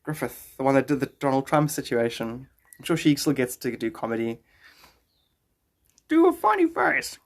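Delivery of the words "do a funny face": much higher and slow; slow squeaky cartoon read; squeaky silly voice. Reading request in a voice.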